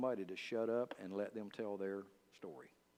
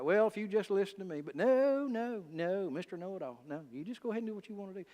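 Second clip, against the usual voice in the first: high-pitched, sarcastic scolding; hammed-up nagging in a raised pitch; mock exasperated spouse; 8 semitones higher, 4 dB louder than usual. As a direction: play up the fed-up spouse, pitch jumping high. Acted marital quarrel, scolding.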